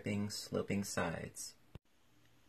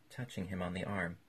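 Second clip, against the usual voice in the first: usual speaking voice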